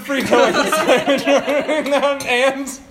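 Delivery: giggling while hosting; trying to talk through a laugh; laughing through intro. Real, not acted. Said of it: "redoing the intro laughing".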